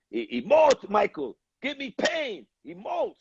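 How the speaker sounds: hammed-up German accent, pitch climbing; German accent yell. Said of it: "He was screaming a thick German accent".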